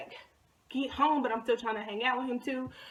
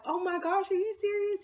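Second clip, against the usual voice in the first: high-pitched, retold girlish gushing; thrilled disbelief; 8 semitones higher than usual. Girl answering her crush's proposal, thrilled. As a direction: pitch it way up, swooning at the news.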